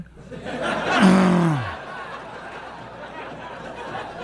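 long incredulous laugh; rising disbelieving laugh. Real, not acted. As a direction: laugh that climbs high in disbelief. Reacting to wife's news.

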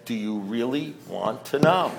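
slow, low, soft sponsor voice; imitating a sponsor's measured challenge; sponsor's question. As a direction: ask it slowly and quietly, letting it hang. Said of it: "And then he said".

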